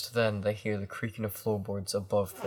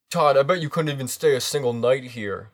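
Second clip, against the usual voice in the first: put-on higher, louder, fast teen taunt; cocky teen boy; 4 semitones higher, 11 dB louder, faster than usual. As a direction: dare him like a bratty teen. Voicing Jason.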